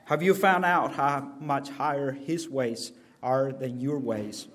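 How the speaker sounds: ordinary delivery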